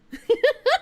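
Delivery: giggling at her own joke; high burst of laughter. Real, not acted.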